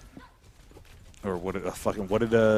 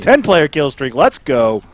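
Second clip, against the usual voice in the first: loud, higher kill-streak celebration; hype shout; 6 semitones higher, 18 dB louder, slower than usual. Real, not acted. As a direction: yell it like a hype announcer. Hyped gamer boast.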